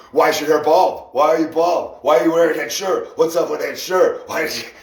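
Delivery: mock heckling teammate.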